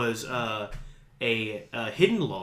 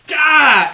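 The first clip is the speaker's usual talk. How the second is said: high loud mock grunt of effort; a sudden yelled battle grunt; effort cry; 14 dB louder than usual. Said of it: "and is like".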